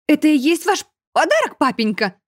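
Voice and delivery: high cracking defiant question. A narrator's reading. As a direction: squeak mid-phrase from fear. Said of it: "от страха пустив петуха". (голос срывается на петуха)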